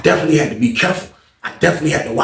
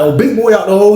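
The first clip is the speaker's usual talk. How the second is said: taunting call; 7 semitones higher, 4 dB louder than usual. Retold or imitated. Teasing the suspected snitch.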